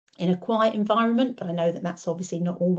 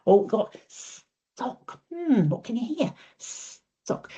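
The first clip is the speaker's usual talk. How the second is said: slow, drawn-out modelling; 4 semitones higher, much slower than usual. Shown as a demonstration. Modelling to child.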